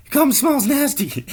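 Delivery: very high, loud nagging screech; nagging wife yell. Acted. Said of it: "And she says".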